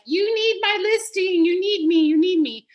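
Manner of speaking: high, pushy mock insistence; a retold client pressing hard; mock demanding. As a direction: imitate her pushing you need me. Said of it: "she basically was like".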